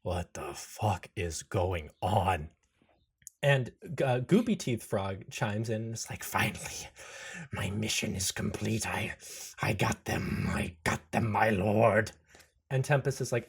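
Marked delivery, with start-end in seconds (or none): 0.0-2.5 frozen shock. Acted. 6.2-12.1 strained, whispery frog croak; goopy frog creature. Acted. Frog reports the finished mission to its lord, hoarse triumph.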